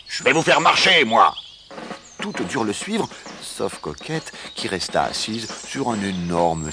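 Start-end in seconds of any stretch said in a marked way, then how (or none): 0.1-1.3 loud, raised-voice warning; shouted threat. A narrator's reading. Rooster threatens the hens with a march, shouting. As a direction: threaten them at full voice. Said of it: "cria-t-il".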